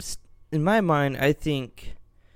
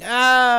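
falling tree scream; 8 semitones higher, 8 dB louder than usual. Pretend. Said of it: "that tree going".

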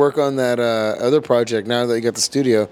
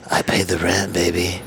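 lower, crooning pillow talk; mock sweet nothings; 6 semitones lower than usual. Shown as a demonstration. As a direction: sell it like a smooth talker. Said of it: "You start whispering little sweet nothings".

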